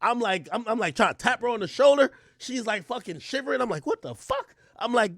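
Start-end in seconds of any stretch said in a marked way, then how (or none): 3.9-4.5 much higher confused outburst; comic high disbelief; shocked squeal. Retold or imitated. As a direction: shriek it in disbelief. Girl shivering, he is confused.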